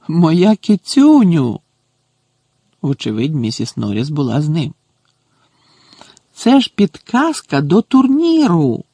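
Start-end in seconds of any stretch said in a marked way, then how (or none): 0.1-1.6 loud, higher, drawn-out endearment; excited cooing. A narrator's reading. Caretaker exclaiming to his beloved cat, thrilled. 6.3-8.8 high, louder exclamation; excited discovery. A narrator's reading. Caretaker telling his cat about the tournament clue, excited. Caretaker realises what he found.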